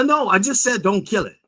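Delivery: stammer, then protest high and loud; exasperated retold protest. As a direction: insist in a high voice that you already said it. Retold exasperated repeat to animal control.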